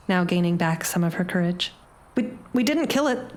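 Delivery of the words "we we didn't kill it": put-on stammering, nervous boy; a scared kid stumbling over words. A narrator's reading. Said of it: "utters nervously".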